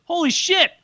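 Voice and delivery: high-pitched retold astonishment; amazed realization. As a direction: mimic a fan's amazed gasp of words. Retold fan's amazed recognition.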